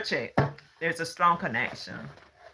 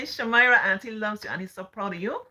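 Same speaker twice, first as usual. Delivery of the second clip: calling her name high and loud with pride; proud auntie shouting out her niece; excited call-out; 6 semitones higher, 5 dB louder than usual. Real, not acted.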